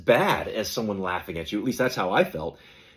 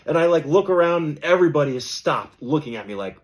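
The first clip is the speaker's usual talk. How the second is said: ordinary talk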